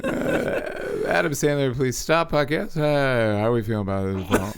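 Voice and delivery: imitating a podcast host. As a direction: mimic the host's greeting, hammed up. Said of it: "Is that how I sound?".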